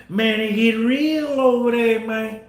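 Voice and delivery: Southern US accent, mildly imitated. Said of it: "that down south accent".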